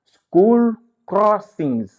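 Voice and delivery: slow, loud and high "school crossings", stress on "school"; drawn-out "school crossings" for the class; teacher projecting "school crossings" slowly; emphatic model word. Shown as a demonstration.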